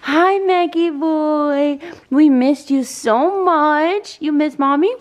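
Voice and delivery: put-on high, sing-song baby talk. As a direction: coo to the baby. Coos at baby and dog.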